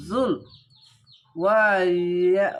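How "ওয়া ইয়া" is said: ওয়া ইয়া drawn out slowly and loudly; careful Quranic syllable recitation; slow recited syllables. Shown as a demonstration. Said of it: "দেখেন, ওয়া".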